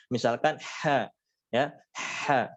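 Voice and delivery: ha said with air flowing out; breathy ha. Shown as a demonstration. Teacher demonstrating the airy ha, breathy. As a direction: let the air escape on ha. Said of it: "pasti keluar udaranya".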